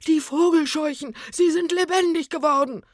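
high, loud panicked cry; shrieking alarm; terrified scream. A narrator's reading. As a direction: yell it in horror. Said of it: "schrie der Mann auf".

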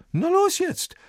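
very high, loud command; barking order. A narrator's reading. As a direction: snap at him to get going. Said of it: "herrscht ihn der Mann an".

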